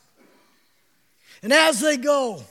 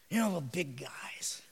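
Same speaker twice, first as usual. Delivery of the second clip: whispered aside; 9 semitones lower, 16 dB quieter than usual. Real, not acted.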